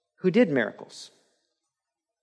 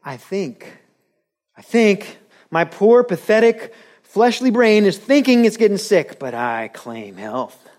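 mock faith confession; 6 semitones higher, 5 dB louder than usual. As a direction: parody a believer claiming health over sickness.

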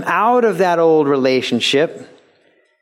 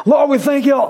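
mock Australian worship leader; 11 semitones higher than usual. American worship leader mocked putting on an Australian accent. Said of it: "they got the Australian accent".

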